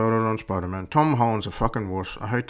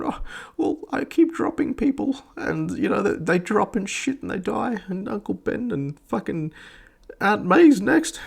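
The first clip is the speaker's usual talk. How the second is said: high, mock-tearful whine; exaggerated sobby imitation, high-pitched; Andrew Garfield; 9 semitones higher than usual. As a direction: blubber through it in a crybaby falsetto. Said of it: "you get blubbery fucking crying".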